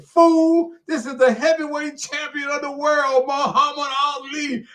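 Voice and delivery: high, loud opening into a proud proclamation; grand self-announcement. Retold or imitated. Ali waking Bell by phone, grandly announcing himself. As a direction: imitate a boxing champ announcing his own title.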